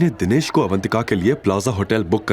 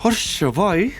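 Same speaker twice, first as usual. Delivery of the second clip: higher, shrill-pitched scornful female voice; jealous accusing wife; 11 semitones higher than usual. A narrator's reading. Nandini accusing Avantika and Shivay, jealous and scornful. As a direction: accuse them with jealous contempt.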